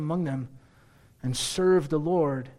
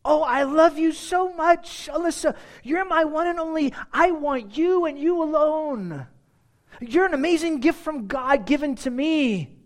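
gushing husband; 11 semitones higher, 3 dB louder than usual. Pretend. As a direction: pour it on like a besotted husband. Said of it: "Versus him doing this".

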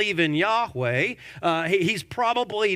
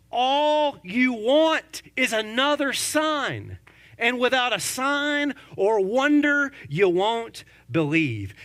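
stern Jesus rebuke; 5 semitones higher, slower than usual. Acted. Voices Jesus rebuking, slowly.

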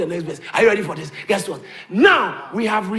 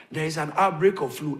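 usual speaking voice

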